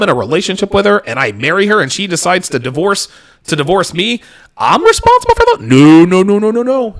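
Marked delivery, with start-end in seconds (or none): 4.6-5.6 high, incredulous squeak; a stunned stepdad, pitch jumping high; outraged disbelief. Acted. Man imagining the stepdad law. 5.6-7.0 emphatic refusal. Acted. Man imagining the stepdad law.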